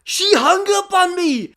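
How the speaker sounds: high, loud indignant cry; outraged disbelief. Retold or imitated. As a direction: shout it in scandalized amazement. Outraged retelling of hang-up.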